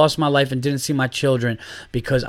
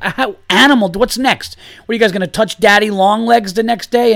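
high, loud incredulous yelling; a dad mocking the camp at full shout; outraged disbelief; 8 semitones higher, 5 dB louder than usual. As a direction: hurl the question in disbelief. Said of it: "I was like".

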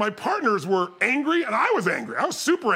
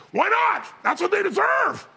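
high-pitched indignant retort; mock fury, pitched way up; imitated enraged partner; 10 semitones higher than usual. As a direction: fire back like an outraged friend. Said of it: "he's so much more intense".

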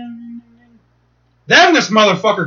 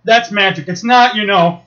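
ordinary talk, nothing marked

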